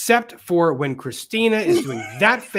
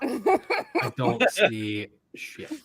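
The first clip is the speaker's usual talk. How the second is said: laughing; 6 semitones higher, much slower than usual. Real, not acted. Laughing while searching.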